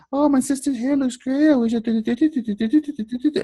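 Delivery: high gushing imitation; mock gushing sister. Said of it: "You know".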